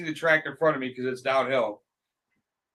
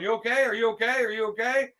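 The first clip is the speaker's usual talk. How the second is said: higher, repeated anxious questions; imitated concern; 6 semitones higher, faster than usual. Imitating a friend checking on a silent rider, concerned.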